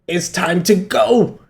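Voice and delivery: lightly acted hero getting down to business; fired-up chef. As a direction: announce it slowly, like a showdown starting. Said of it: "Azan's like".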